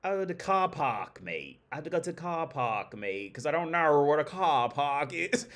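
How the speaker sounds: hammed-up Aussie, "car" said as "cah"; mock Australian accent. Mocking Australians dropping R's, in a hammed-up Australian accent. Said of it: "it's a R in car".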